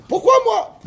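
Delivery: put-on high whining child's complaint; mock child whining why me; whiny kid. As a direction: whine it like a sulky son. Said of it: "Qu'est-ce qu'il a dit le, le fils?".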